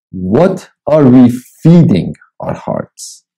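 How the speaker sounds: slow, deliberate reading from his book; devotional reading. Acted. Preacher reads his devotional book to listeners, slow and measured. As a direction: read each line slowly and let it land.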